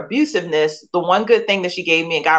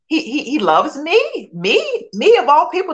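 a retold rush of wonder that anyone could love her; disbelieving amazement; 9 semitones higher than usual. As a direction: ask it like you can't believe it's true. Retold amazement at being loved.

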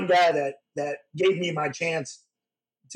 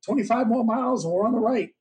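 higher, upbeat relaying of directions; retold prosthetist on the phone; 6 semitones higher than usual. Prosthetist on the phone telling him to come up, higher and upbeat. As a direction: rattle off directions pitched up like an eager helper. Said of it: "He goes".

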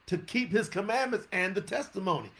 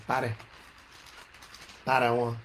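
thirty said as "tahty" in a country accent; 9 semitones lower, 3 dB quieter than usual. Shown as a demonstration. Preacher showing his country voice on "thirty-one", country accent. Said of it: "I can say in my country voice".

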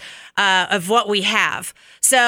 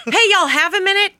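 higher-pitched casual boss summons; pitched-up mock-friendly request; imitated boss; 8 semitones higher, faster than usual. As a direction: lightly voice a boss popping in.

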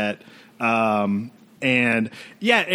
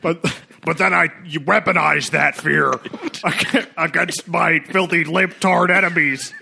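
Alex Jones voice; 4 semitones higher than usual. Pretend. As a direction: bellow it like a conspiracy talk host. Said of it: "just sounds so on brand Alex Jones".

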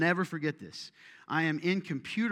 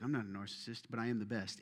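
mock narcissist; 5 semitones lower, 8 dB quieter than usual. Mocking narcissist in the room.

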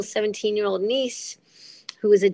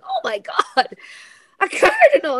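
very high, squealed amazement, getting louder; excited exclamation; 14 semitones higher, 5 dB louder than usual. Retold or imitated. Retelling a cardinal landing during casting call, amazed high cry. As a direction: re-enact a high-pitched cry of astonishment. Re-enacts startled cry on seeing bird.

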